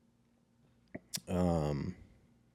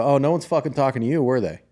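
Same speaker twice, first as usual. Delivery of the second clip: louder, higher, rapid retort; retold snapping put-down; 6 semitones higher, 5 dB louder, faster than usual. Grandchild silences grandmother butting in, retold snapping put-down. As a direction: re-enact cutting someone off sharply. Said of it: "never spoken to my grandmother like that".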